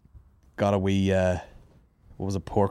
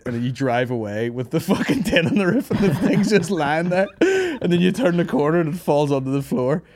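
higher, laughing through the words; 7 semitones higher, 3 dB louder than usual. Retold or imitated. Maureen picturing the scene in bed, laughing. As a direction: giggle helplessly while telling it. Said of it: "Maureen started laughing her head off".

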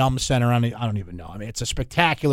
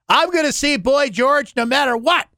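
determined fairgoer; 12 semitones higher, 5 dB louder than usual. Retold or imitated.

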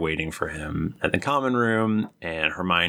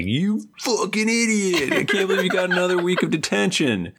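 high, fast laughing tirade; mock cussing out, high and fast; mock scolding; 12 semitones higher than usual. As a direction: laugh through a fake angry rant at Harry. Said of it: "she's like".

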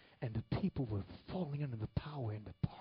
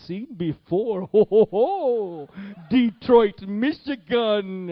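slow, thundering prophetic impersonation, rising higher and louder; Mahesh Chandra; 7 semitones higher, 17 dB louder, slower than usual. Acted. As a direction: build a grand vision to a huge, high ho-ho-ho climax. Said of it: "this is how he talked".

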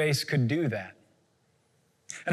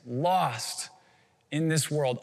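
ordinary talk

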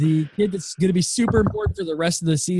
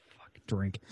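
breathy whispered curse; whispered aside; 7 semitones lower, 12 dB quieter than usual. Real, not acted. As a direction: mutter it under your breath. Drinking game penalty aside.

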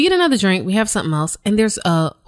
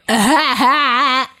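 mock laugh; 4 semitones higher, much slower than usual. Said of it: "and then started laughing".

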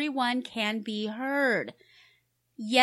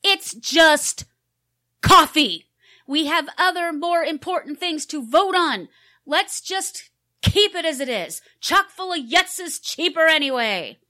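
loud, raised, fed-up voice; exasperated yelling; 8 dB louder than usual. Acted. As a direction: shout it, losing patience. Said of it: "Stop yelling".

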